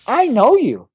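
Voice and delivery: put-on high, chirpy child's voice; little boy voice. A five-year-old tells the pastor, in a high child's voice. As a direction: pitch it up like a small kid.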